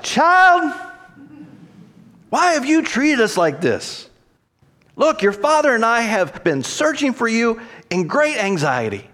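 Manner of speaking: loud high cry of a worried parent; frantic mother calling out her child; anxious, exasperated mother. Acted. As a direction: scold the lost child in high-pitched distress. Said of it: "It might have been more like".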